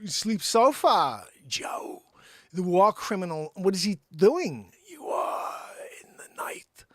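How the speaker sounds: slow, halting impression with odd pauses and whispered drops; haltingly paced celebrity news-reading impression; Christopher Walken. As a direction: stretch words out, then drop suddenly to a whisper. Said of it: "we're gonna do a little Christopher Walken".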